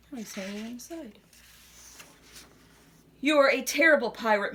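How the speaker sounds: plain reading voice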